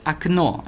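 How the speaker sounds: окно said akNO, first o reduced to a; Russian pronunciation. Shown as a demonstration. Teacher models окно for the class. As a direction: stress the end of окно. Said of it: "aqui virou um som de A".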